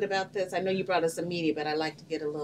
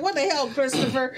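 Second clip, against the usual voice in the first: high and louder, laughing; laughing mock exasperation; 7 semitones higher, 4 dB louder than usual. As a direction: blurt it out through laughter. Host voices confessor, laughing.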